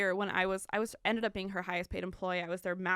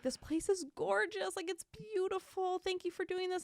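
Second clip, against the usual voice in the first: airy mock-gushing customer voice, pitched way up; gushing clients; 9 semitones higher, 4 dB quieter than usual. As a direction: play the delighted clients, lifted up high. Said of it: "they were like".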